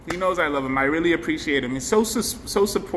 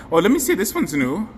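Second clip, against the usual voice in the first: usual speaking voice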